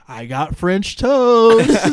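slow, loud goofy dad voice. Retold or imitated. Dad returning home, slow loud oblivious voice. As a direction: drawl it like a proud clueless dad. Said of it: "the dad's come back".

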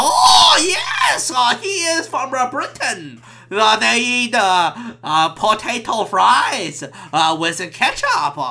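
mock Cantonese accent. Waiter catching on about the Briton, hammed-up Cantonese accent. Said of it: "that's a Cantonese accent".

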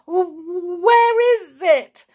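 high, loud, slow panicky stutter; terrified stammer. A narrator's reading. Cecil, shaking, asks where the bull is, stammering. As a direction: stutter the first sound in fright. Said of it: "he stammered".

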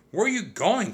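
high-pitched urgent question; crying out. A narrator's reading. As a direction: call after someone in alarm. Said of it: "cried Annie".

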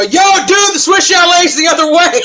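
shouting out the window. Retold or imitated. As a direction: holler it to a stranger down the street, cracking up. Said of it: "I open the window and go".

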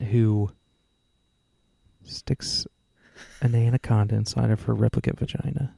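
hushed, breathy whisper on the first word. Real, not acted. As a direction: drop to a secretive whisper. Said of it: "Why are you whispering like that?".

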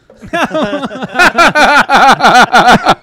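bursting into loud laughter; a big, loud belly laugh. Real, not acted.

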